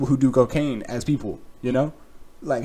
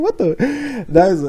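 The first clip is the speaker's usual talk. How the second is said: ordinary talk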